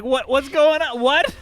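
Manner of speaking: high, loud hammed-up shock; loud mock disbelief; mock alarmed wife. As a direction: squeal it like a panicked wife. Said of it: "And the wife is like".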